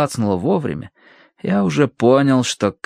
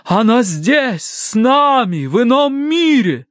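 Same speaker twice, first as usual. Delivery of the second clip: high, drawn-out otherworldly wail; spirit-channelling wail; 13 semitones higher, 4 dB louder, slower than usual. A narrator's reading. Madame speaks for the dead cat, drawn-out wail. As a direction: wail slowly as the medium.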